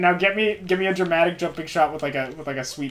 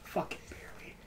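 whispered grumble; whispering; 7 semitones lower, 21 dB quieter than usual. Real, not acted. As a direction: breathe it out unvoiced. Muttering grudging comment.

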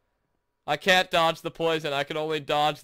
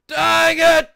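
high, loud outburst; frustrated shout; 12 semitones higher, 11 dB louder than usual. Real, not acted. Player failing a weird level, frustrated yell. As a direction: shout it in sudden annoyance.

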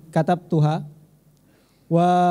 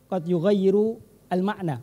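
ordinary delivery

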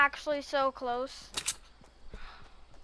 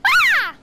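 sharp high yelp of fright, louder; high startled scream; 11 dB louder than usual. Real, not acted. Player startled mid-game, high yelp. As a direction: shriek in sudden panic.

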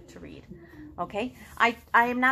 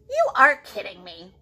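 loud, stunned disbelief; can't believe what she hears; disbelieving Piggy; 4 semitones lower, 9 dB louder than usual. Acted. Piggy gasps at her friend in disbelief. Piggy voiced in read-aloud.

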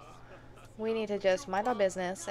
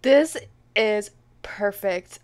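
loud, slowed-down ironic cry; sarcastic outburst; 10 dB louder, much slower than usual. Real, not acted. Gamer reacts to the wife noticing, loud sarcasm. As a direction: say it loud, meaning the opposite.

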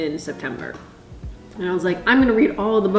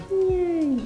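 high squeaky little yay; high cheer; 11 semitones higher than usual. Real, not acted. Mock cheer.

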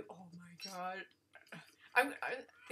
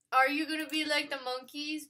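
ordinary delivery

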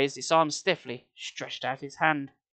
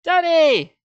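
much higher, loud joyful shout; joyful cry; 13 semitones higher, 10 dB louder than usual. A narrator's reading. Sari calling out to her father, joyful cry. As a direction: call out in relief. Girl sees father alive.

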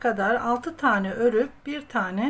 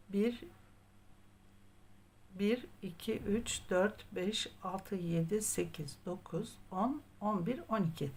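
soft, murmured counting while knitting; counting under breath; 10 dB quieter than usual. Real, not acted. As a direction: count the stitches quietly to yourself.

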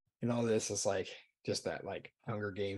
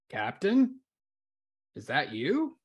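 higher, louder questioning voice; puzzled crew member; 6 semitones higher, 7 dB louder, slower than usual. Acted. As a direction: call out in doubt to a superior. Crew member unsure it's the captain.

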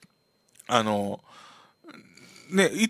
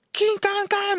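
high clanging "キンカンカン" like a cleaver on metal. Retold or imitated. Narrator imitating cleaver noise from the kitchen, high metallic clang. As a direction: ring it out high like a cleaver striking. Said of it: "包丁の音聞こえるんですよ".

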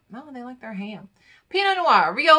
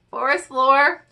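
laughing; 6 semitones higher, 5 dB louder, slower than usual. Real, not acted. Laughs reading wine notes.

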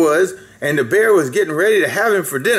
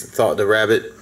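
plain reading voice

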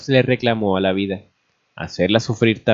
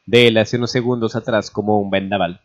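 plain reading voice

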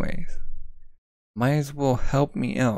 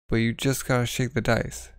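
ordinary talk, nothing marked

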